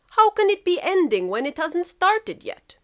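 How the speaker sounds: Russia voice. Acted.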